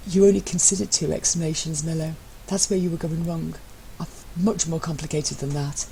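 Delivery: a hint of smug superiority; lightly voiced smug Matt. A narrator's reading. Matt correcting husband, lightly voiced smugness. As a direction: savour being one step ahead.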